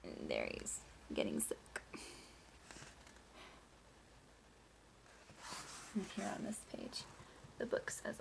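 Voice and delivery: soft whisper to the class; whispered aside. Real, not acted. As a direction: lean in and whisper it.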